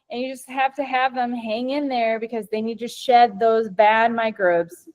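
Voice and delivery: ordinary delivery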